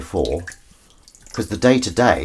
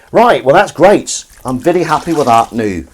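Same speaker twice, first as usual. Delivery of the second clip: put-on loud, high, stray Scottish-like accent; accidental stray accent; 6 semitones higher, 7 dB louder than usual. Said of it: "I don't know where their accent was".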